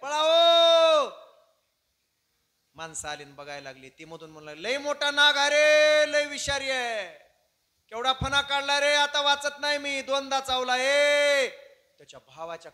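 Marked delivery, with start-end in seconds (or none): 0.0-1.1 high, very loud, long-drawn calls; acted wife's full-force alarm cry; yelling for help. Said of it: "तिथून जाऊन ओरडू लागली". 4.5-11.5 high, loud, drawn-out cries; acted full-force wail of alarm; panicked shouting. Snake victim crying out for help.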